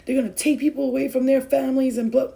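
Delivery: loud, rushed mock panic; panicked followers. Vlogger imitating alarmed followers' DMs, loud and rushed. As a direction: imitate frantic messages from scared followers. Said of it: "people are sending me all these D Ms like".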